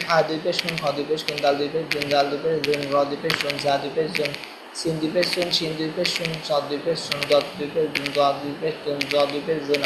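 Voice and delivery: soft, level-toned recitation; rote spelling drill. Shown as a demonstration. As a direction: chant each letter spelling softly to an even beat. (নিচু গলায় একটানা বানান মশক)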